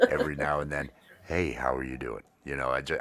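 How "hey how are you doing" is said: lightly lowered voice; deep voice. Shown as a demonstration. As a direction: drop the voice down low. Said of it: "I like to lower it an octave".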